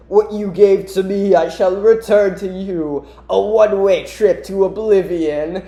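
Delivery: lightly hammed-up menace; Mephiles. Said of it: "He's like".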